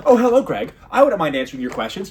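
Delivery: high, loud, overly polite chatbot; mock the chatbot's syrupy courtesy; condescending AI voice. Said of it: "A I has that really kinda condescending, overly polite talk".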